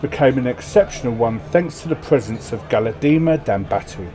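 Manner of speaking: loud, grand storyteller reading; event narration. Acted. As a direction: read the event like a saga. Reads game event text aloud.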